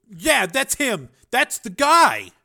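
put-on high, loud, slow outburst from a boy; excited recognition. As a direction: burst out as you recognise the attacker. Boy recognises his attacker's photo.

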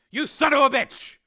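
high, loud angry curse; furious outburst. Acted. Engineer cursing the senator, furious. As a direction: spit it at him.

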